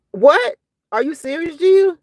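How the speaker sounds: high-pitched disbelieving question; astonished, voice lifted; incredulous. Real, not acted. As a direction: react in real disbelief, pitch climbing. Reacts in disbelief to chat news.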